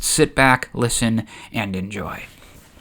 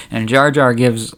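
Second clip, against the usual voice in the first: ordinary delivery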